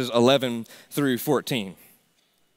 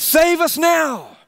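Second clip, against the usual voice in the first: crowd's cry; 9 semitones higher, 5 dB louder than usual. Retold or imitated. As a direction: call out as the crowd once did. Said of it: "the same people who said".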